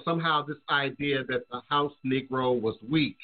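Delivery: normal talking voice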